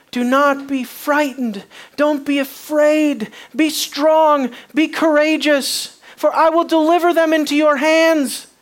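high, loud and forceful, each command driven home; fervent exhortation. Acted. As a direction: preach God's charge to be strong at full volume. God's words quoted to Joshua.

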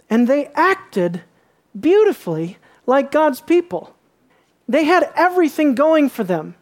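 fervent sermon rise; preaching emphasis. Acted. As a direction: swell into the point.

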